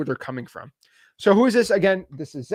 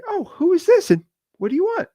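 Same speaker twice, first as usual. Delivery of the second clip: much higher, sweet and polite; polite caller voice; 13 semitones higher than usual. Shown as a demonstration. Seller answering a cold call, politely, in a high sweet voice. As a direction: ask it nicely, like a friendly homeowner. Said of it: "Now, it's never like that".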